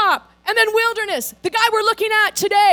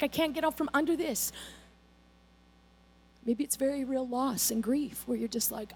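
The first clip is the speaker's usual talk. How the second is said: soft despair; 6 semitones lower, 12 dB quieter than usual. Retold or imitated. Voicing listeners' despair.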